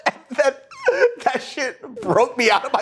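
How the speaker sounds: high-pitched, laughing through the words; helplessly cracking up mid-sentence. Real, not acted. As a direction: barely get it out through laughter. Laughing at own imitation.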